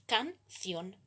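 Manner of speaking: "canción" slowly, with a clear pause between can and ción; split into syllables. Shown as a demonstration. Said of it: "La división en sílabas es".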